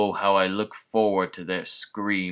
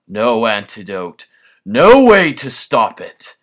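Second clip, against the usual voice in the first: villainous rant; 8 dB louder than usual. Acted. Villain rant builds to triumph.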